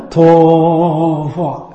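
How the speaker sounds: stretched final syllables; dragged ending. Shown as a demonstration. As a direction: draw out 陀佛 at the end. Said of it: "非要搞这么剩一下".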